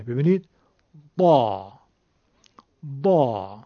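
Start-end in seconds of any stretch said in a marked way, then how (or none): 1.2-3.6 با with a thick emphatic d; heavy dal. Shown as a demonstration. Teacher showing the Egyptian zad as a heavy dal. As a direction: say با deep, with a heavy dal sound.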